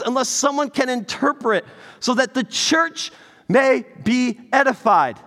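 loud, high, slowed preaching; fervent pulpit preaching; preaching at full force. Acted. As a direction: drive the point home to the church.

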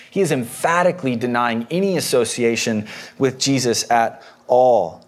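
usual speaking voice

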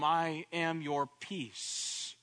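I am your peace, drawn out slowly; solemn, slow promise; slow declaration. Shown as a demonstration. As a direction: let each word of I am your peace land slowly. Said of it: "I am your Sar Shalom".

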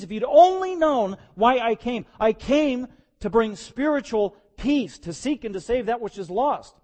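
loud, high, anguished lament, slowing at the peaks; Jesus crying out. Acted. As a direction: cry out to your people, heartbroken. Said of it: "He cries out so they could hear it".

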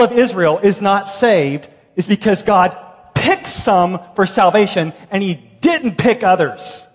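preaching at full force. Acted. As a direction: press the point hard to the congregation.

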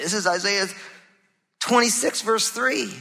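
normal talking voice

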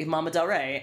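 usual speaking voice

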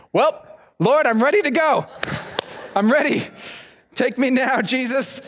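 high, dramatic prayer to the Lord; mock prayer. Missionary kid prays before the stranger arrives, hammed-up. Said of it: "And I was like".

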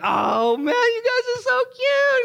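high, squealing mock gush, drawn out; excited gushing. Woman greeting an engaged couple, hammed-up excitement. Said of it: "she was so excited".

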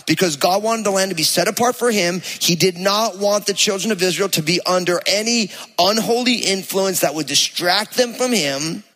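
ordinary talk

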